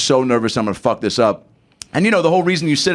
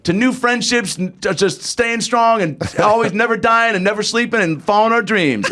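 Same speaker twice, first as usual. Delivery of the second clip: gushing toast; 9 semitones higher than usual. Retold or imitated. Acts out his gushing toast.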